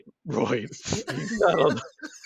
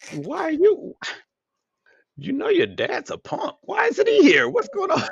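mock outraged; 6 dB louder than usual. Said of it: "I'm like".

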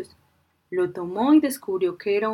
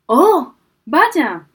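startled sigh; 6 semitones higher, 8 dB louder than usual. A narrator's reading. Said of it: "suspiró".

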